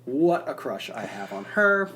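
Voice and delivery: usual speaking voice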